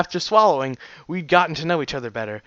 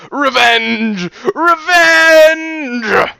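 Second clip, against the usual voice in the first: high, full-blast drawn-out yell; hammed-up vengeful roar; battle-cry shout; 11 semitones higher, 11 dB louder, much slower than usual. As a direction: scream for vengeance, stretching the word out. Acted war cry during headlock.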